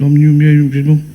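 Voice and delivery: wordless drone of a vicar at a graveside; mock clerical mumble on one note; mumbling vicar. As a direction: imitate a vicar's monotone mumbling. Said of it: "the vicar come along with".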